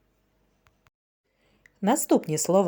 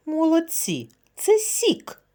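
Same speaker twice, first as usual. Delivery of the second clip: Це сік sung out much higher; bright praise; 9 semitones higher, slower than usual. Shown as a demonstration. Teacher revealing the answer to children, excited and high.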